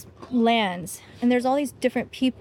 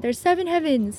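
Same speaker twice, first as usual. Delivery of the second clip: put-on dad's Indian accent, a bit higher; lightly imitating a dad's Indian accent; 5 semitones higher than usual. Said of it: "in his Indian accent".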